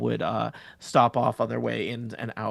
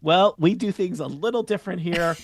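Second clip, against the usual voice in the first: bright, higher barmaid greeting; cheerful bartender; 6 semitones higher, 3 dB louder than usual. Acted. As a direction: welcome them in like a friendly barkeep. Bartender continues.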